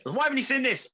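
mock indignant shout; mock impatient demand. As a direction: demand an answer from the absent friend. Imagined impatient question.

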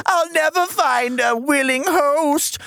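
high, slow, whiny self-pity. Retold or imitated. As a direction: moan it like a sorry demon. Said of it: "self-pitying about it".

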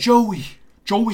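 Joey in a British accent, first one high and loud. Shown as a demonstration. Host demonstrating the British Joey for cohost, high British accent. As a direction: say Joey the British way, bright and lifted. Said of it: "How do you say it in a British accent?".

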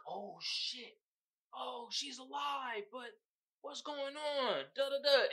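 high, slow spooked quote; lightly voiced scared twin; mock startled twin. Twin waking from nightmares, slow spooked.

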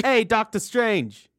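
put-on slow, lightly done New York accent; a mild mock New York greeting. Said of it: "That's not too bad".

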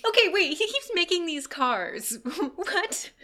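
high, loud, laughing incredulity; loud squeal of baffled amusement; amused disbelief. Real, not acted. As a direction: blurt it out in giddy disbelief.

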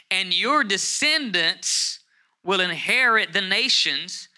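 high, loud, stretched words with breaks between; forceful scripture proclamation. Acted. Preacher urging the congregation through the verse, emphatic proclamation. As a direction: drive each command home slowly.

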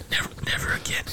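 hushed, teasing whisper; whispered echo. Retold or imitated. Cohost echoing the apology for laughs, whispered. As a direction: echo it back under your breath.

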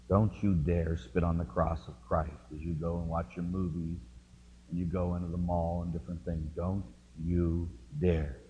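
low, stern warning, slowing on the last dare. Retold or imitated. Pastor warning the kids off movies, stern and low. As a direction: warn them sternly, lingering on dare.

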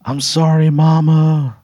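high, loud broken wail; wailing yell. Acted. Jilted groom sobbing to his mom, loud high wail. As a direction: cry it out from your knees. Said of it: "you just hear him yell".

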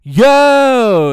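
high, loud bro holler; loud yell. Retold or imitated. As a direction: shout it like a hyped frat bro. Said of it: "Kyle was like".

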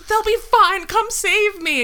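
high, loud mock desperate plea; high pleading. Wife begging husband on phone to come save her, high and loud. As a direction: squeal it like a frantic rescue plea.

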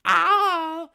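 tiny squeaky cartoon yelps, very high; high-pitched Kirby cries; Kirby impression. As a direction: yelp it like a cute pink puffball. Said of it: "I could probably do Kirby's".

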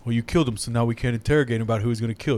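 lightly voiced buddy. Sidekick complains the assassin can't be questioned, lightly voiced. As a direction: gently grumble about the lost lead. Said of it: "his buddy's like".

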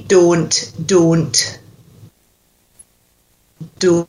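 "Don't" with a pure long O; Scottish "Don't", modelled for a learner; Scottish O sound. Shown as a demonstration. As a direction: say "Don't" with one unglided O, each evenly. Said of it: "say it for me".

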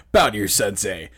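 stern sensei command, lightly voiced; sharp martial arts commands. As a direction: bark it like a dojo master. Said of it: "what that voice reminds me of".

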